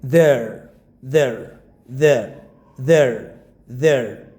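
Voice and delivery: "there" said as one short falling syllable for "they're"; contracted they're. Shown as a demonstration. Teacher repeating the right short form of they are, falling "there". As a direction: drill "there" again and again, each falling. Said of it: "But not they are".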